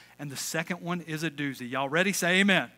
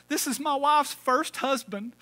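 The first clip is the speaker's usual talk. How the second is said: crying; 9 semitones higher, 4 dB louder than usual. Retold or imitated. Grieving man at graveyard.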